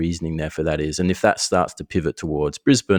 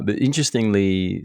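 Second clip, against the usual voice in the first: ordinary talk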